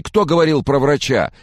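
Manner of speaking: high, loud, alarmed question; panicked questioning. A narrator's reading. Caller alarmed at talk of a doctor, panicked. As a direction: demand an answer in alarm.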